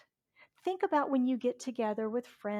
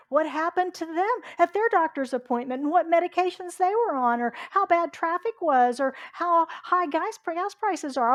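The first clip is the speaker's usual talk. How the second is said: mock complaining; 6 semitones higher, 8 dB louder than usual. Mimicking people piling on complaints.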